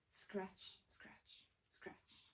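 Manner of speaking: hushed scratches fading to almost nothing; whisper, trailing away; soft eerie whisper. A narrator's reading. Spooky noise fading.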